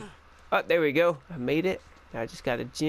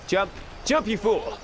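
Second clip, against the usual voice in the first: higher, hammed-up order; mock urgent command; 5 semitones higher than usual. Player orders Drake to jump, mock urgent command. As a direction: urge them on with playful exasperation.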